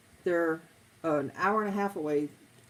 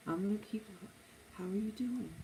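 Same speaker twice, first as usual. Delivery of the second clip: softer, soothing customer-service voice; lowered calm voice; 3 semitones higher, 9 dB quieter than usual. Shown as a demonstration. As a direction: bring the volume down to calm a caller. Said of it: "you keep lowering your voice".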